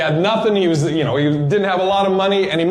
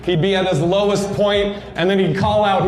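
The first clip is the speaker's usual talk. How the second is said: ordinary delivery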